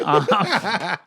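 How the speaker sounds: normal talking voice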